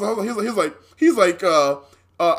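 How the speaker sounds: usual speaking voice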